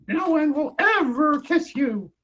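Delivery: slow, high witch voice laying a curse; evil witch curse. Acted. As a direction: cast the spell like a wicked witch. Evil witch casts the spell.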